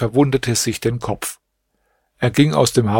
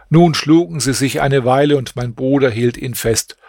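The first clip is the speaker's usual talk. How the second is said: usual speaking voice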